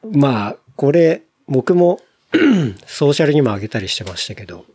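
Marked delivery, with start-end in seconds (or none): 2.3-2.8 short sharp throat clear. Real, not acted. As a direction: clear the throat mid-sentence. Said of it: "咳がね".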